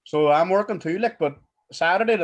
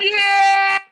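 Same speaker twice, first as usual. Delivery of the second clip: loud, much higher excited yell; shout; 14 semitones higher, 10 dB louder than usual. Real, not acted. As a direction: shout it out big. Shouting into the phone.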